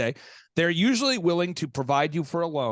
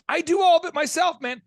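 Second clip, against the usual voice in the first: high, loud, fed-up outburst; exasperated retort; 12 semitones higher, 5 dB louder than usual. Retold or imitated. Host snapping back at a helpless doctor, loud and exasperated. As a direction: blurt it out, totally done with him.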